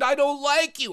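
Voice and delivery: high-pitched indignant protest; upset child. Acted. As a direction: protest like a cornered little girl. Girl protests under pressure.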